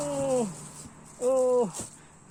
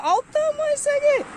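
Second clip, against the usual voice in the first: amazed at the size; 11 semitones higher than usual. Real, not acted. Showing the huge kale.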